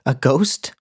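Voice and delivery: amused scorn at a naive question; laughing at him. A narrator's reading. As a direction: scoff with a laugh. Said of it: "laughing at his ignorance".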